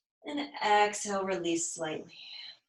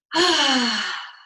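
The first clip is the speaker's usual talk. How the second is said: loud voiced sigh, falling from high; audible sigh; 5 semitones higher, 7 dB louder than usual. Shown as a demonstration. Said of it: "sigh it out with some sound".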